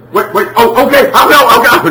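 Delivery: high, loud, hurried mock pleading; panicked child. Child pleading with mom to avoid punishment, mock panic. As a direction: imitate a kid scrambling to obey in alarm.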